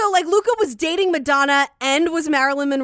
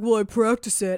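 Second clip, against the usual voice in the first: forcing a deep voice; 4 semitones lower than usual. Retold or imitated. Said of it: "he has this fake deep voice".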